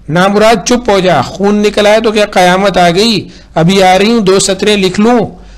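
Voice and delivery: high, loud irritated scolding; scolding mother. A narrator's reading. Mother scolding her crying son from her desk. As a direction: snap at the child, high and loud.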